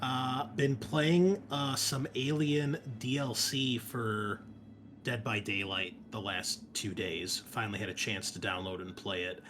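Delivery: ordinary talk, nothing marked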